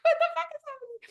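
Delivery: very high laughing squeal; high-pitched laughing shock; laughing disbelief. Retold or imitated. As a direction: crack up in disbelief. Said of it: "I was like".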